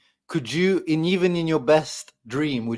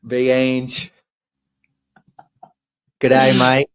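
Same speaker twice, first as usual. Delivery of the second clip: Aussie impression; 5 dB louder, slower than usual. Mocking Ange with Aussie greeting.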